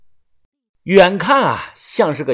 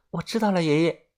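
small voice; 3 semitones higher, 7 dB quieter, faster than usual. A narrator's reading. Xiaoxia meekly answers grandpa, soft timid voice. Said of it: "低着头小声地说".

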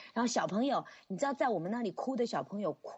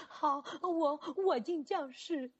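put-on reluctant child; 6 semitones higher, much slower than usual. Child giving in.

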